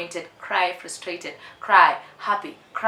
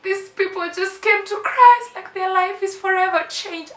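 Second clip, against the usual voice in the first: retold teary, much higher voice; tearful reply; 7 dB louder than usual. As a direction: squeeze it out high, as if about to cry. Retelling her tearful reply.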